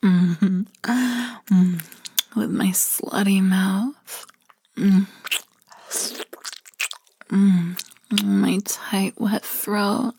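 ordinary delivery

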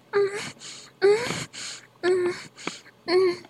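soft high-pitched moaning; high moans. Real, not acted. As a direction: moan softly, high in the voice.

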